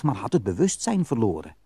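usual speaking voice

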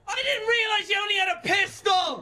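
exasperated shout. Real, not acted. Yelling at teammate mid-fight.

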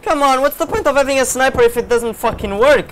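loud, high-pitched exasperation; angry gamer rant. Real, not acted. Gamer complaining that the sniper doesn't work, angry rant. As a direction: rail at a useless weapon.

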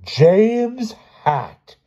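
high, loud scolding teacher; stern schoolmistress catching a culprit; loud, sternest voice. A narrator's reading. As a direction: call out the full name sharply. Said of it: "said loudly in her sternest voice".

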